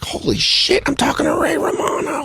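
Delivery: high, hushed awe; starstruck hush. Retold or imitated. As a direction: whisper it to yourself, amazed by a celebrity. Voicing starstruck inner thought.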